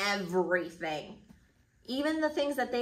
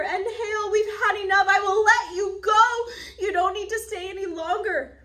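high, loud, tearful begging; a proud king cracking into sobs as he begs; weepy pleading Pharaoh; 11 semitones higher, 10 dB louder than usual. A narrator's reading. Pharaoh begging Moses to stop the hail, high tearful plea. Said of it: "He confessed".

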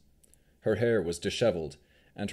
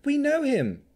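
very high, loud outburst; sudden high-pitched interjection; blurted out; 6 dB louder than usual. A narrator's reading. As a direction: blurt it out eagerly. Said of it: "Navarra blurted out".

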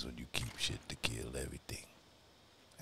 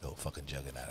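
ordinary talk

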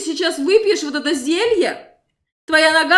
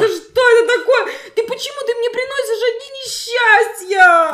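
high, fast, laughing outrage; outraged shriek; 7 semitones higher than usual. Acted. She scolds the guest after the fall, high fast outrage. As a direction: scold him for bringing bad luck.

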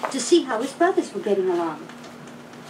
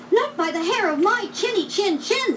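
little pig; 10 semitones higher than usual. A narrator's reading. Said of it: "said the little pig".

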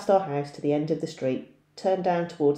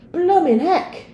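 loud, much higher gasp of surprise; startled exclamation; 9 dB louder, slower than usual. Acted. Boy startled by the cracking garage, shouting in surprise. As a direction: blurt it out in shock.

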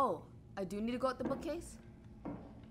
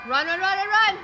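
frantic high panicked yelling; being chased; 8 semitones higher, 12 dB louder than usual. Real, not acted. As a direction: scream it while running for life. Fleeing again.

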